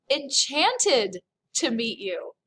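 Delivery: Enchanted stretched and stressed, slow fall; slow savoured phrase. Shown as a demonstration. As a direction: dwell on Enchanted, slowly. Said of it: "look at this word, enchanté".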